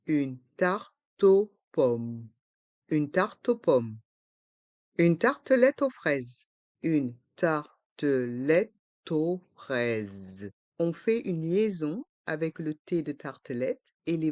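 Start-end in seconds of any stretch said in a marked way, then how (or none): 0.1-2.3 slow "Une tarte aux pommes", with gaps, T joined to aux; learner-paced, split "Une tarte aux pommes"; syllable by syllable. Shown as a demonstration. Teacher breaks phrase into syllables. 6.8-10.5 slow "Une tartelette aux fraises", every syllable apart; learner-paced, split "Une tartelette aux fraises". Shown as a demonstration. Teacher breaks phrase into syllables.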